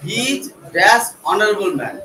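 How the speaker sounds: very slow, word by word dictation; dictating slowly. Shown as a demonstration. Teacher dictating a fill-in-the-blank sentence, very slowly. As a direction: read it out as if dictating to the class. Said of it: "reading out a fill-in sentence".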